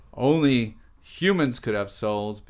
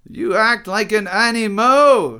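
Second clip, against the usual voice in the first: high, loud acted shout; Derrida; 14 dB louder, slower than usual. A narrator's reading. Derrida at the honking driver, shouting. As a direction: yell it at full pitch. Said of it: "shouted Derrida".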